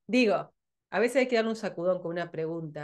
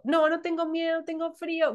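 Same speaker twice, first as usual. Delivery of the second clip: higher mock whine of excuses; whiny client; 7 semitones higher than usual. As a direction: mimic a scared client making excuses, higher. Mocking a scared client's excuses. (imitación burlona de cliente asustado, más agudo)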